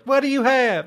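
put-on high-pitched mom calling to a kid. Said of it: "the mom's like".

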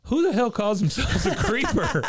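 high, laughing incredulous question; laughing disbelief. Real, not acted. Host reacting to Gilbert calling himself a creeper, laughing. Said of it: "laughing disbelief".

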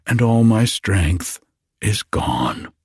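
ordinary delivery, nothing marked

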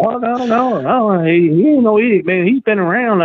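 hammed-up nervous protest; imitating the flustered wannabe. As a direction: protest too much like a caught liar. Wannabe defending trainer.

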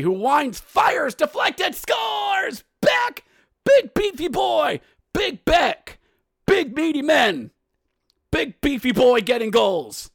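high, shouted hockey goal call; excited goal call. Real, not acted. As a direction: erupt as the puck goes in, voice way up. Calling a goal being scored.

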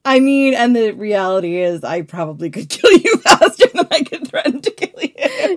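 loud, laughing through the words; cracking up mid-sentence. Real, not acted. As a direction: start loud, break into high laughter. Joking threat dissolving into laughter.

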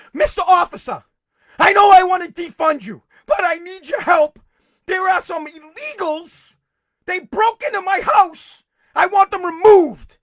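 high, loud, pleading mock voice; mocking a Democrat begging police for help, hammed-up plea; mocking caller. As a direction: lampoon a panicked homeowner begging police.

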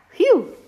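a high, loud relieved exhale; big relieved phew. Acted. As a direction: blow out relief like after a close call. Story relief after escaping cow.

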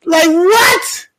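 high-pitched disbelief; incredulous outburst. Real, not acted. Host stunned by what the preacher called them, incredulous. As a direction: burst out baffled, pitch jumping up.

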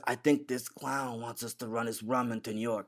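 hushed mobster quote; quiet, put-on character voice; Luca. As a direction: say it softly, as an unimpressed mobster in a retelling. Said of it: "and Luca says".

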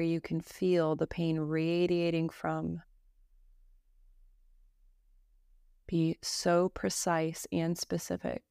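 slow, even meditation voice with long pauses; calm Yoga Nidra guidance, evenly pitched; guided meditation. Acted. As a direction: guide them gently through breath and body, unhurried.

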